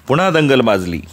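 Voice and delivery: ordinary delivery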